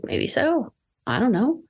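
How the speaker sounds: gentle reassurance. Retold or imitated. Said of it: "And I said".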